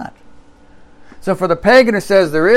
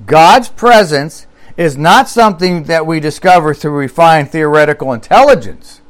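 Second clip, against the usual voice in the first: ordinary delivery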